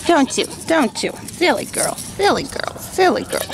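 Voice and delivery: high, sing-song voice for a dog; talking to the dog in a high voice; high pet voice. Real, not acted. Cooing at the dog.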